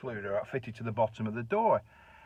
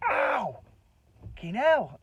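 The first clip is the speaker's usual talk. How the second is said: mock pain yelp; 12 semitones higher, much slower than usual. Fakes being hurt to wind him up.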